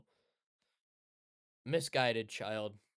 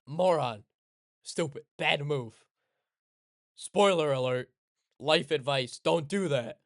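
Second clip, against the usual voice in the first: loud scolding; 6 dB louder than usual. Real, not acted. Scolding the character.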